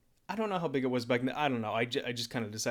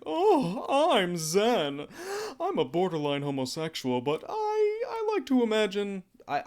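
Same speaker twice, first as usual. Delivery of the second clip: much higher, louder, slow and showy; flamboyant Zen; 9 semitones higher, 6 dB louder, slower than usual. Acted. Streamer voicing flamboyant Zen for his viewers. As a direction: camp it up, theatrical flair. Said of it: "Zen is flamboyant, so he's like".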